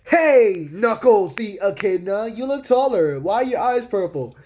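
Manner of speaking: high, hammed-up cartoon voice; Sonic. Sonic greeting a changed Knuckles, high cartoon voice. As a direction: hail a friend loudly, then stay high and curious. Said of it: "Sonic responds with".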